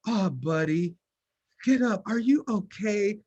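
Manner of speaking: put-on baby the boy who fell off the bike; coddling parent. Cooing to son after fall.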